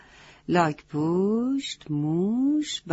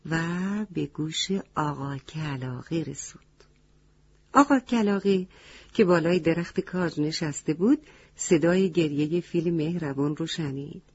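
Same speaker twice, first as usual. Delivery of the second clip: usual speaking voice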